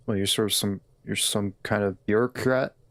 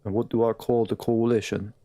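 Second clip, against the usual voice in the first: a light attempt at a British accent, mostly his own voice; lightly voiced British accent, reading the game line. Streamer trying a British accent on a game question. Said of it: "I'm gonna do an accent right now".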